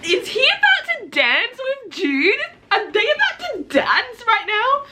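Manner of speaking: excited fangirl squeal; giddy excitement. Real, not acted. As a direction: squeal like a fan whose ship is sailing. Excited about dance scene.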